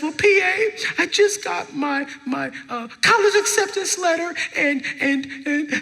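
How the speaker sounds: high, halting mock-crying voice; imitated girl trying to talk while crying; tearful student. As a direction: stammer through exaggerated tears. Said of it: "and she's crying".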